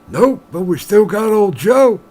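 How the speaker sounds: put-on high, loud, slow old-timer bartender. Bartender telling the miner about old Joe, high and slow. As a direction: drawl it out like an old barkeep.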